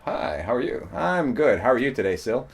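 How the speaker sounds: playing both sides of small talk; hammed-up greeting to oneself; mock self-conversation. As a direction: chat with yourself, alternating voices. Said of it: "I don't mean have a conversation".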